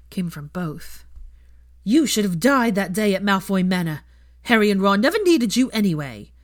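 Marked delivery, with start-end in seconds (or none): 1.8-6.3 louder, hateful tirade; a former friend's hateful letter; cruel howler. Acted. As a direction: wish her dead, loudly.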